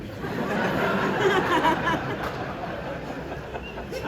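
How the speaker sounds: long high rising laugh; cracking up at the picture. Real, not acted. Father cracking up at his son's photo, high laugh.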